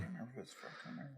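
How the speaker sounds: very soft, barely voiced aside; murmured aside. Real, not acted. Host unsure of the grid size, murmuring to self. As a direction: let it drop to a murmur.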